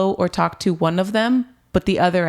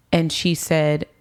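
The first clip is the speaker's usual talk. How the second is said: normal talking voice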